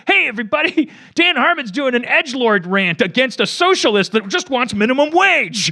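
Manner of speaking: high, loud mocking announcer voice of the internet crowd; mocking online crowd. Dan mocking his online critics in a loud, high, gleeful voice. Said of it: "there was all these".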